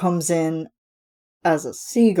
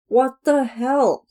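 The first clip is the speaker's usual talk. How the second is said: much higher, slow indignant what the hell; mock outraged surprise; 7 semitones higher, slower than usual. [name]'s Ursula interrupted by the chef, mock outrage. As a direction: act cut off mid-triumph. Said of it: "And then".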